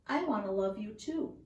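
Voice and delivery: usual speaking voice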